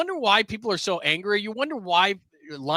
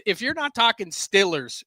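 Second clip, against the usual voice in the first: ordinary delivery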